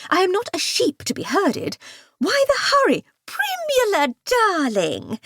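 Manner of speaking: very high, gushing fake sweetness; affected society lady. A narrator's reading. Dahlia feigning delight at her rival, very high. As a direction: coo at your rival with false delight.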